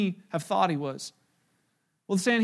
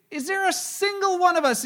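much higher, scornful question; incredulous priest; 9 semitones higher than usual. Retold or imitated. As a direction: scoff at the guards, voice shooting up. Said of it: "he said to the".

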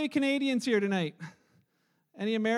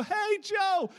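higher, bright waving call from a bus window; kids calling out; 8 semitones higher than usual. Retold or imitated. Kids on a bus greeting the visitor, calling out. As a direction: shout it as a kid waving out the window.

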